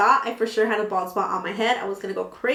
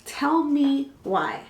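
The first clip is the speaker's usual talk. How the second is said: each word stretched very slowly; dramatically slow, spaced-out TELL ME WHY; drawn-out emphasis; 5 semitones higher, much slower than usual. Acted. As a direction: drag it out for storytelling effect.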